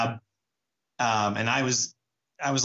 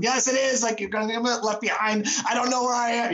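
much higher, frantic mock panic; panicked protest; 10 semitones higher than usual. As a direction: squeal it like a lost, tipsy kid in a strange city. Panicked retort to reassurance.

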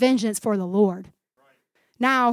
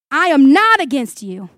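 emphatic declaration; 2 semitones higher, 9 dB louder than usual. Acted. Acts David's declaration to Saul.

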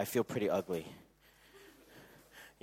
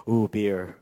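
mock distracted husband; 4 semitones lower, 4 dB louder than usual.

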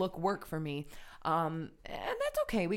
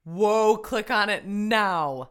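loud, slow, emphatic order; excited demand; 4 semitones higher, 11 dB louder, much slower than usual. Real, not acted. Co-host reacting to a trending name, excited demand. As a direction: seize on it with big loud urgency. Mock-eager reaction to a headline.

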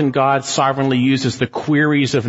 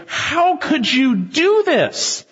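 high, slow, stunned question; aghast sailors; 12 semitones higher, much slower than usual. Retold or imitated. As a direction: drag it out in horror. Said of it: "And they say".

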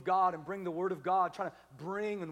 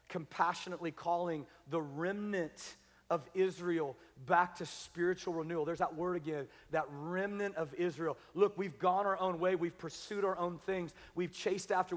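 usual speaking voice

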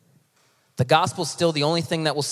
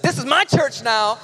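higher, slower, emphatic declaration; delighted lady, imitated; 6 semitones higher, 4 dB louder than usual. Another lady declaring her new church, imitated delight. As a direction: imitate someone proudly claiming her place. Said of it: "Another lady was like".

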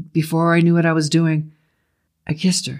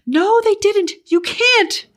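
very high frantic woman's voice; high, distraught outburst; frantic pleading; 3 dB louder, slower than usual. A narrator's reading. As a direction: protest desperately, high-pitched. Betty yanks and pounds him, pleading.

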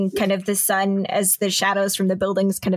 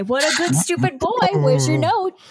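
put-on high baby talk to a dog; 6 semitones higher than usual. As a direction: coo at the dog like a pet owner.

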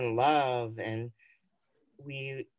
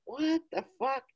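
baffled; 9 semitones higher than usual. Retold or imitated. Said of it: "And I'm like".

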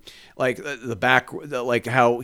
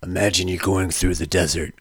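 low gravelly dramatic narrator; deep, brooding movie-narrator rasp; Mickey Rourke gravelly voice; 8 semitones lower than usual. Retold or imitated. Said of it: "Mickey Rourke's gravelly voice".

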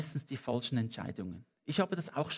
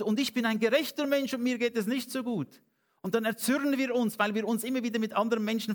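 fervent preaching; 6 semitones higher than usual. Acted. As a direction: preach it out, rising with conviction. Preaching at full force.

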